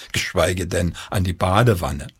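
plain reading voice

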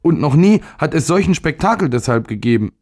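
put-on high-pitched, upset boy; near tears. A narrator's reading. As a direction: protest like a child about to cry. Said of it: "im Begriff, in Tränen auszubrechen".

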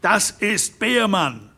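laut rufend; shouting. A narrator's reading. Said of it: "schrie Hermann Derrick".

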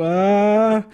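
sharp high cry of shock; short shriek. Acted. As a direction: psychiatrist sees the kangaroo, startled shriek. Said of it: "entfährt ihm ein spitzer Schrei".

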